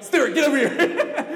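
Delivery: high, loud laughing call across the room. Retold or imitated. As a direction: shout for Stuart with a laugh. Calling friend over across room.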